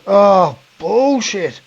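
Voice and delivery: high, hammed-up disbelief. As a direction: fake shock like a bloke. Prankster feigning shock.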